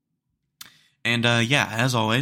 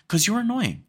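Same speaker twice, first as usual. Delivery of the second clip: high, exasperated jab; 11 semitones higher than usual. Real, not acted. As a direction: snap at them, fed up. Rant at excuse-makers.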